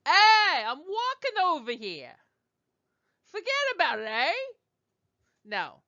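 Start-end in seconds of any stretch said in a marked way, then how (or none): 0.0-4.3 much higher, hammed-up Brooklyn holler; Brooklyn accent. Shown as a demonstration. Teacher mocking the wrong vowel, loud Brooklyn accent. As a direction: holler like a cartoon Brooklyn local. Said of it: "it's not like Brooklyn".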